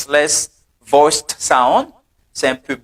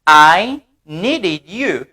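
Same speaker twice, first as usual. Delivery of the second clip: "I needed you" très lentement, en appuyant sur "needed"; slow model sentence; much slower than usual. Shown as a demonstration. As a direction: drag out "I needed you" for learners. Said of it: "You see". ("I needed you" lentement, "needed" accentué)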